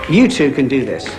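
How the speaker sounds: ordinary talk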